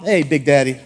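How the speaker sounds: low teasing purr with a laugh; flirty wife. Retold or imitated. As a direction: tease your husband playfully, low and with a laugh. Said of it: "just give him a pat".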